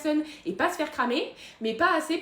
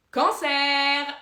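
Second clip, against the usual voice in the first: louder, delighted cry of the word; exclamation triomphante; 2 semitones higher, 6 dB louder than usual. Acted. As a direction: burst it out like a winning answer. Punchline exclamation about star sign. (exclamation forte et triomphante)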